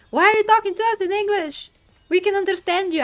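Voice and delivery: loud, high, fast mock scolding; imitating judges' loud protest; retold indignant judges. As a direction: demand it in exasperated disbelief. Retells judges scolding her for English.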